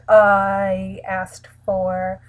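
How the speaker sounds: normal talking voice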